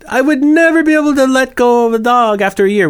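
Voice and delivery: high, gushing sympathetic voice; imitating gushing people. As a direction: gush like an admiring stranger. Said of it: "So everybody says".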